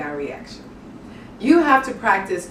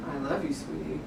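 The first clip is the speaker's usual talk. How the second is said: "I love you, sweetie" mumbled much softer and lower; barely there; 5 semitones lower, 13 dB quieter, faster than usual. Shown as a demonstration. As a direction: let it die away, no feeling. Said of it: "is not the way to go".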